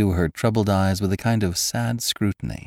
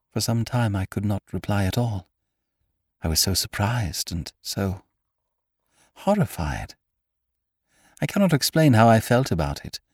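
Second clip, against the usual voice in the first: put-on slightly higher, distressed young woman; a hint of a troubled girl; lightly voiced young woman; 4 semitones higher than usual. Girl telling Cairn of the proposal, troubled.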